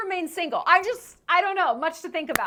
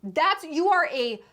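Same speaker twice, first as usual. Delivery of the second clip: ordinary delivery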